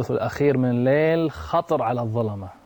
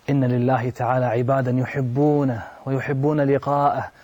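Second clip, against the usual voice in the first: ordinary talk, nothing marked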